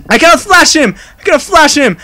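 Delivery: high, urgent cry; panicked protest. Real, not acted. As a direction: blurt it out in rising panic. Cannot repel game enemy.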